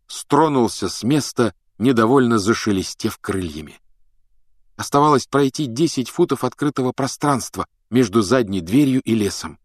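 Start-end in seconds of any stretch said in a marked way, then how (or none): none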